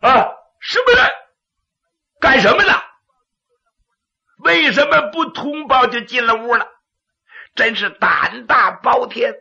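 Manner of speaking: high, loud alarmed yell; startled shout. Acted. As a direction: jump in fright and shout.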